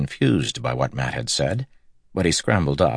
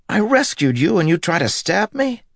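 much higher, louder outraged cry; outraged shout; 4 dB louder than usual. Acted. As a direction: let the disbelief boil over. Matt's outrage escalates.

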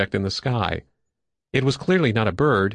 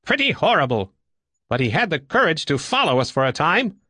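higher-pitched, lightly severe character voice; severe pilot; 6 semitones higher than usual. Acted. Woodbine judging the timid stranger, higher and mildly severe. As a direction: answer your own question in a raised voice.

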